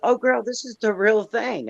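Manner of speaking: put-on high-pitched enthusiastic girlfriend; retold hype. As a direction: talk it up like an eager friend. Hyping friend, retold.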